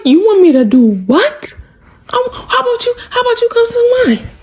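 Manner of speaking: high-pitched mock disbelief; incredulous retort. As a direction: play it scandalized, pitch leaping up.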